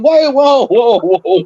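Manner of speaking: high startled whoas; alarmed whoa. Retold or imitated. Barber stopping the client's hammer talk, high alarmed whoa. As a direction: stop him in alarm.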